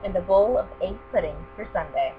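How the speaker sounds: ordinary delivery, nothing marked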